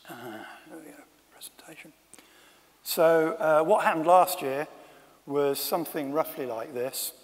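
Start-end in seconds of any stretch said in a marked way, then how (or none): 0.1-1.9 very soft, quick, whispered self-talk; muttered aside. Real, not acted. Speaker to himself while finding the presentation, muttered aside. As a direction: murmur it under your breath.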